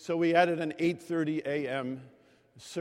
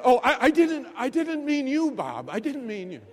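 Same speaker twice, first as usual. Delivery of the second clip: put-on flustered old lady; 9 semitones higher, 6 dB louder than usual. Iva backpedals to Bob.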